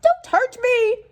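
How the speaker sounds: high, loud pleading cry; frightened lion whimpering. Acted. Lion backing away from the mouse, scared pleading. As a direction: beg not to be hurt. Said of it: "he whimpered".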